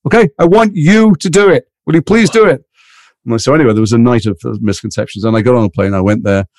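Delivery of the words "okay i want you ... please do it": high, pressing plea, slowing on I want you; raised, earnest mock insistence; urgent pleading. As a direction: beg him to take the part. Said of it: "He goes".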